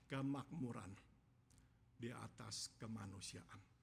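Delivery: low, soft phrases with pauses between; solemn, hushed. Shown as a demonstration. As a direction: drop the voice and let each phrase weigh. Solemn naming of Elkanah's values.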